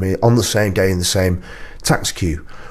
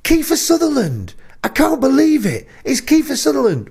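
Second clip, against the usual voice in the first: much higher, thrilled cry of a star's name; starstruck fan. Retold or imitated. Bloke mistaking the host for a film star, high and thrilled. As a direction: gasp it out like meeting your idol. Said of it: "And then he went".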